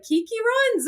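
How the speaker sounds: high-pitched happy recognition; excited greeting. Retold or imitated. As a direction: call it out high, delighted to recognize someone. Quotes hosts greeting her excitedly.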